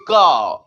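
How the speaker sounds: का drawn out as "kaw"; correct open vowel. Shown as a demonstration. Teacher repeating the right sound, said "kaw". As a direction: hold an open "aw" in का. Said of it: "वो निकलना चाहिए".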